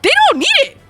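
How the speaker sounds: building disbelief that peaks high; climbing from annoyed to squeaky outrage; exasperated rant. Real, not acted.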